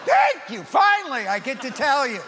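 loud, high cheer of relief; shouted thank you, finally; excited spouse. Acted. Spouse celebrating the admission.

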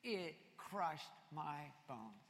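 slow, soft, heavy psalm line; somber reading. Retold or imitated. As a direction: let the weight of guilt slow it. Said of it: "what the psalmist says here".

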